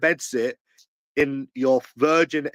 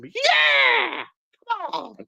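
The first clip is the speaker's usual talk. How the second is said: triumphant cheer; much slower than usual. Real, not acted. Celebrating his own taunt with a high whoop. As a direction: cheer like you just won. Cheering himself after insult.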